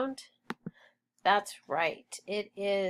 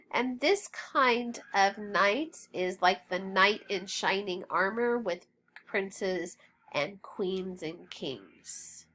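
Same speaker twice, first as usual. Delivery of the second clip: normal talking voice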